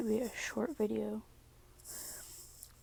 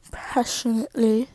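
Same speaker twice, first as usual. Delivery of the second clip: loud emphasis; 8 dB louder than usual. Real, not acted. Dramatic emphasis after whisper.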